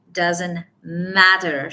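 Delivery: slow, landing hard on matter; stress on matter. Shown as a demonstration. Coach shows how to use it, stress on matter. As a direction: hold back, then hit matter.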